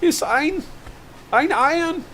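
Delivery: high, hammed-up German accent; playful fake German accent. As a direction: burst into a mock German voice. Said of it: "I don't know where that German accent just came from".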